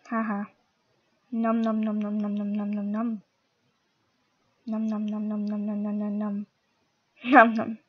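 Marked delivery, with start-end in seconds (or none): 1.4-7.8 steady low nom nom eating sounds; chomping noises. Pretend. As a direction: chomp like a hungry worm.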